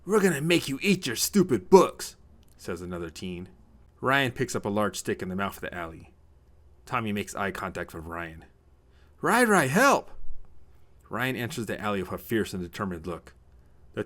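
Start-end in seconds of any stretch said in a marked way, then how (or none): 0.0-2.1 loud, high-pitched jeer; threatening bully. Acted. Another teen threatens cornered Tommy, bullying. 9.2-10.1 high, loud plea; cry for help. Acted. Cornered Tommy spots his brother, crying for help.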